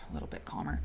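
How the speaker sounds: soft breathy whisper, winding down; whispered calm. Real, not acted. Host telling her chat she feels calmer, whispered. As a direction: let it drop to a whisper as you relax.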